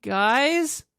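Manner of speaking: high, loud call; crying out. A narrator's reading. Ash calling for friends, high and loud. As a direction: call out into the dark. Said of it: "I cried out alone".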